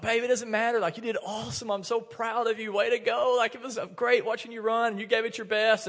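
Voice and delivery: put-on reassuring a disappointed little girl after her race, gently soothing; gentle reassurance. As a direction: comfort your disappointed daughter. Comforting disappointed daughter on knee.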